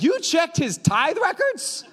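high, slow, laughing disbelief; a daughter laughing in shock at her dad. Retold or imitated. Daughter reacting to her dad checking records, high incredulous laugh.

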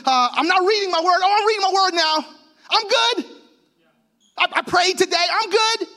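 high, hurried self-reassurance; mock self-assurance. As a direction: reassure yourself in a rising, eager rush. Acting swings in self-rated standing.

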